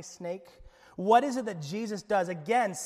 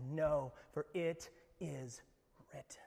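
unvoiced whisper, slow; whispered rebuke; 5 semitones lower, 9 dB quieter than usual. Believer refusing Satan's lies, whispered. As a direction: breathe the refusal quietly.

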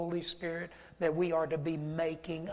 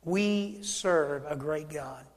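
ordinary talk

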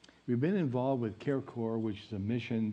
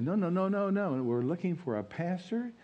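higher-pitched retold protest; insistent protest; 7 semitones higher than usual. Caller correcting a pastor who thinks it's a prank, insistent protest. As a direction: cut in with a run of quick denials, mildly acted.

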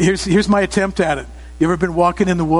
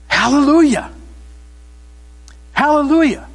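loud, higher-pitched Hallelujah; rousing congregational call; 9 semitones higher, 3 dB louder than usual. Shown as a demonstration. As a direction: call it out for everyone to join in. Leading congregation in a shout.

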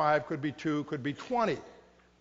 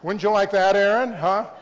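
louder, laughing jab at a friend; teasing laugh; 3 semitones higher, 11 dB louder than usual. Real, not acted. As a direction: tease Aaron with a grin. Teasing a listener about tithing.

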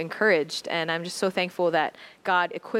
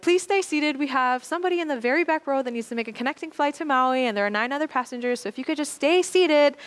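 flight attendant announcement; 7 semitones higher than usual. Retold or imitated. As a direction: call it out to the whole cabin.

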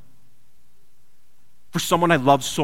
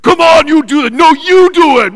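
high, loud squabbling shout; two brothers bickering in a storm, shouting; brothers yelling; 16 dB louder than usual. Retold or imitated. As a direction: shout at each other in a panic. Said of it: "They're yelling at each other".

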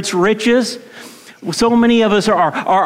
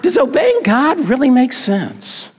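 Eve doubting; 6 semitones higher than usual. Retold or imitated. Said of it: "she's beginning to say".

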